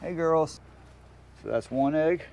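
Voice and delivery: ordinary talk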